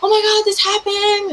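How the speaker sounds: frantic relief rushing out into the rain; panicked oh my God. Retold or imitated. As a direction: shriek it in panic. Runs out terrified to grandparents.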